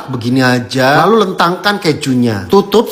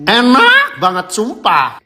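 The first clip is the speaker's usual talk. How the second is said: excited; 6 semitones higher than usual. Real, not acted. Excited craving for a new dish.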